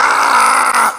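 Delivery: long, high held "aah" cry; mock anguished yell, drawn out; imitated father's wail. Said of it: "lloraba y gritaba y decía".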